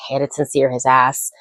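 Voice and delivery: usual speaking voice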